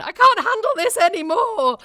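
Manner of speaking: high-pitched comic protest; exasperated wardrobe. Acted. Host voicing an overstretched wardrobe after all that bread, comic exasperation. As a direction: squeal it as an overwhelmed object giving up. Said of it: "my entire wardrobe went".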